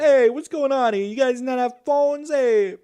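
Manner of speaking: loud, high New York tough guy; old-timey New York accent. Retold or imitated. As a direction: bark it like an old New Yorker. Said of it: "old-timey New York accent".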